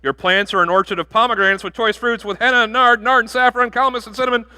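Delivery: giddy groom reeling off spices; goofy gushing groom. Acted. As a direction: gush like a lovestruck groom losing his words. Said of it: "gonna get all, uh, goofy. Here he goes".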